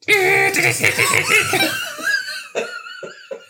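shrill high electronic fax squeal; fax machine screech. Pretend. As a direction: imitate a dial-up fax screeching. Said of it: "That's my fax machine impression".

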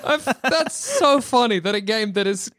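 real laughter in the voice; laughing. Real, not acted. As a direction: talk while cracking up. Laughing at co-host's rant.

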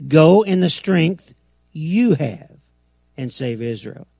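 slow, GO punched hard, "you have" set apart; deliberate command stressing "Go" and "you"; emphasis on the words. Shown as a demonstration. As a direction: hit "Go" and "you", then drop on "save Israel". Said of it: "See how the emphasis on the words?".

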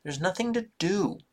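put-on high, loud little-boy complaint; sulky grumble; whiny child. A narrator's reading. Little brother complaining that home is boring, high and whiny.

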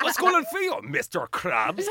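high, loud cartoon character voice; over-the-top TV cartoon impression; cartoon dub voice. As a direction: mimic a squeaky Irish-dubbed cartoon.

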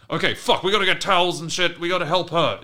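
high, loud acted panic; rally everyone in a panicked rush; imitated frantic call for help; desperate urgency. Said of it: "desperately try and keep Lottie".

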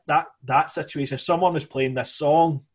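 ordinary delivery

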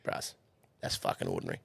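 put-on low, quiet Kiwi accent. Old hand judging the straw box, low Kiwi accent. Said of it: "this old Kiwi fella there".

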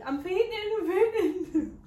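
high, loud, slow mock wail with a laugh; mock fainting wail. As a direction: ham up the fainting panic. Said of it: "'cause I was like".

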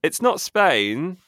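game-show style announcement of the answer; excited reveal. Real, not acted. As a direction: build up and announce it like a prize.

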